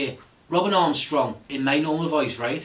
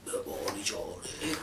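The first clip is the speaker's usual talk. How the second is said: 1 hushed deep voice; 10 semitones lower, 14 dB quieter, slower than usual. Shown as a demonstration. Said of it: "sounds like something I'd say".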